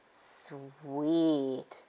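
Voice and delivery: very soft, low snarl; understated snarl. A narrator's reading. Wolf reacting to the sugar sack hiding place, soft low snarl. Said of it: "snarled Wolf".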